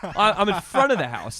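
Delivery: incredulous laughing retort. Retold or imitated. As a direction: laugh at how absurd the question is. Retelling absurd police call.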